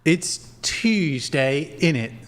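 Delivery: slow, drawn-out mock London innit; mock British accent. Streamer imitates British speech to his guests, slow mock accent. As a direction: stretch out Tuesday like a caricature Brit. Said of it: "they don't talk like that".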